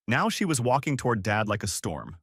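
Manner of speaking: ordinary delivery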